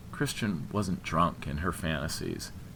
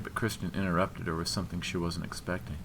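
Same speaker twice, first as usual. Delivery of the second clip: ordinary delivery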